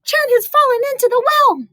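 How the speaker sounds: put-on high, loud frantic child's voice; crying out. Brother running to their mother, high panicked cry. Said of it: "Chen's brother cried".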